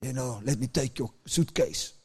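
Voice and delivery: a gentle deep-voiced driver offering help. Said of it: "he comes with his deep voice".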